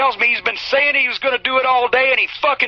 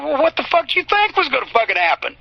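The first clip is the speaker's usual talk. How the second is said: incredulous retort; 7 semitones higher than usual. Retold or imitated. Danny's retort voiced.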